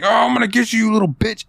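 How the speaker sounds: hammed-up serial killer yelling at the girl; villain's threat. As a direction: threaten her in a cartoonish rage. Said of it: "He's like".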